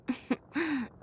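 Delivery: short amused chuckle; a knowing little laugh; light laugh. Acted. Designer amused at her own rules, light laugh.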